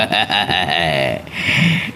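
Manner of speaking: a low, scornful chuckle; amused scornful laugh; low mocking laugh. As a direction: laugh down at the servant's worry. Don laughs at servant's fear.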